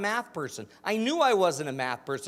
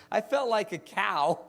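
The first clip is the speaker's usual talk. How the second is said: normal talking voice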